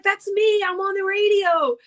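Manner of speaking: high, loud, thrilled announcement; excited. Retold or imitated. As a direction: tell the cab driver with delight. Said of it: "I'm like, I'm like".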